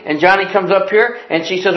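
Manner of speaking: usual speaking voice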